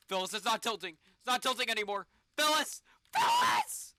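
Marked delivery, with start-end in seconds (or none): 2.4-3.9 very high, loud shriek; panicked scream. Real, not acted. Panicking as the capsule falls.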